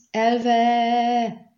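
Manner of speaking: high, loud, long-held yell; roared command. A narrator's reading. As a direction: bellow it and stretch it out. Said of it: "üvöltötte Rienz".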